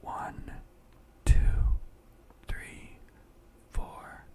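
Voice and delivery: slow, mostly whispered breathing count; guided breathing meditation. Acted. Guide a friend through breathing in and out, hushed meditation voice.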